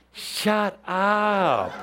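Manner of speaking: high, drawn-out dismissal; mock exasperated cut-off. Teacher silencing self-doubt about being psychic, mock exasperated. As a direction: cut the nagging voice short, pitched well up.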